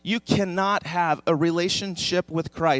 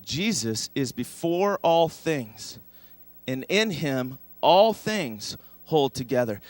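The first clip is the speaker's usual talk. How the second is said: slow recited verse; slower than usual. Shown as a demonstration. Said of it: "Now say it with me".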